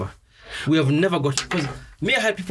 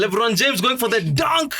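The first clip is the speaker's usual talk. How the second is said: higher, slower play-by-play call; sports commentator; 6 semitones higher than usual. Pretend. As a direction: call the dunk like live TV.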